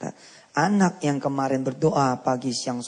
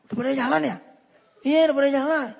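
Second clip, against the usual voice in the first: high-pitched acted exchange, climbing at the end; surprised questioning; 10 semitones higher, faster than usual. Preacher acts out someone meeting the left-behind child, surprised questioning. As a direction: pitch it way up in mock disbelief.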